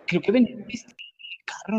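whispered gossip. Real, not acted. Telling friends the girl was drinking in the car, whispered. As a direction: drop to a secret whisper.

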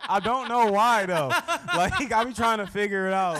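high, loud words through laughter; real laughing. Real, not acted. Teased friend admits he doesn't know why, really laughing as he talks. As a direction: protest helplessly while cracking up. Cracking up after being teased.

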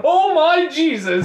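high, loud, slow disbelief; reeling from an unwelcome surprise; shocked exclamation. Real, not acted. Learns the penguin fact is true.

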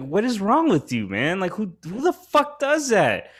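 high, exasperated disbelief at the roommate; replaying the outrage at the roommate, high and exasperated; reliving angry confrontation. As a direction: pitch it up, incredulous and fed up.

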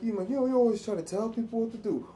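sassy talk-back. Retold or imitated. Kid talking back to stepdad.

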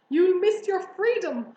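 high, loud warning; honking goose. A narrator's reading. Goose warning pig of captivity, honking. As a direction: honk it after the departing pig. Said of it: "honked the goose".

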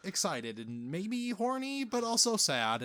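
Tobey Maguire impression. Parody of Peter's awkward speech to MJ, high and halting. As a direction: ham up an awkward, stumbling love speech. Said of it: "Tobey Maguire's weird speech of".

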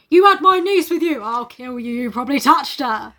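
hammed-up accusing outburst; mock angry threat. Uncle threatening the man who brought his niece, mock angry. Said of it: "He might just be like".

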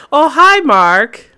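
high, loud hammed-up "Oh, hi, Mark"; Tommy Wiseau. Friend imitating Tommy Wiseau as Zenitsu, high hammy greeting. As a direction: chirp the greeting in a goofy Wiseau voice. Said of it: "if Tommy Wiseau was in fucking Demon Slayer".

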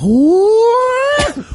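high-pitched wailing ooh; high mocking "ooh". Host mimicking anime sounds, high eerie ooh. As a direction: wail it up high like eerie music.

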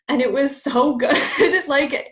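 delighted laughter; can't help laughing. Real, not acted.

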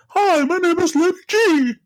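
camp it up as Lady G; mocking Lady G. Mocking the politician's drag persona, high girly voice. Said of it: "he talks like".

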